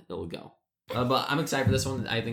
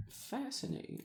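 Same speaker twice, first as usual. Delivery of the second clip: whispered; 12 dB quieter than usual. Acted. Whispered mock awe.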